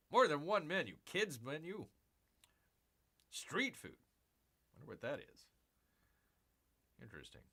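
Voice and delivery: usual speaking voice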